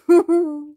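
loud, high triumphant laugh. Real, not acted. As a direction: laugh with satisfaction. Laughing at the banned customer.

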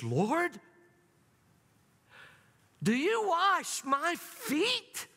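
high, slow disbelieving Peter; incredulous question. Acted. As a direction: stretch out the disbelief. Peter's shocked question voiced.

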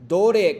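"Dore" said loud with a falling tune; stressed Dore. Shown as a demonstration. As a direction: hit "Dore" loud and drop it. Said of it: "les voy a enseñar vocabularios". ("Dore" fuerte y descendente)

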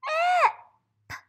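high, loud "ip" called out; shouted ip. Shown as a demonstration. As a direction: burst out "ip" to finish the word. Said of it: "and s".

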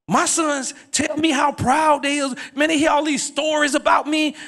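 high-pitched, building preacher's call to the crowd; full preaching. Acted. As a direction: preach it out to the congregation, rising.

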